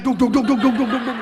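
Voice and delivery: loud, high thudding heartbeat; rapid-fire "duk duk duk", loud and high; pounding heartbeat imitation. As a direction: thump it out like a racing heart. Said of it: "Langsung jantung saya".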